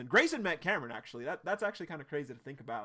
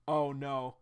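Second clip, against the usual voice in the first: ordinary talk, nothing marked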